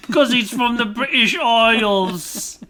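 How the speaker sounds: bad British accent, played for laughs. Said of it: "My British accent's worse".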